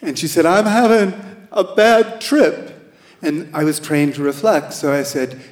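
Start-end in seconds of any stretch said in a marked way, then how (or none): 0.4-2.9 put-on higher, louder girl's voice, distressed; teenage caller. Teen girl to the hotline volunteer, higher and louder. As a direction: play the scared teen on the phone.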